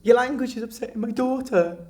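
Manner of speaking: high, loud mocking whine; mock prim mother. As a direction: parody her complaint. Mother's complaint, mocked.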